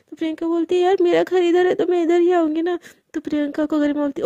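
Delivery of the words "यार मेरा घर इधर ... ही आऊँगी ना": higher, louder exasperated protest; loud, higher exasperated reply; irritated protest. Acted. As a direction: push back, fed up. Friend defends herself, irritated.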